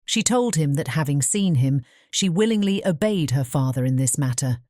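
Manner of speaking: ordinary delivery, nothing marked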